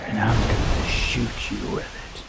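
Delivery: low, breathless creepy threat in a whisper; ominous whisper. Retold or imitated. Strange old lady aiming the water gun at his face, whispered menace. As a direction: whisper it like a curse. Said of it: "she was so ominous with everything".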